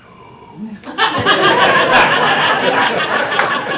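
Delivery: loud, high cackle at his own joke; can't hold back a laugh; storyteller laughing at the tester's mistake; burst of laughter. Real, not acted. Teller cracks up at own story.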